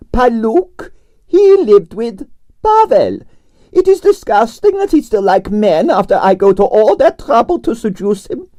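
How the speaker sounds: grand Russian ballerina complaining about her night; Russian prima ballerina. Acted. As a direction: play the dramatic Russian diva. Arienskaya held voice.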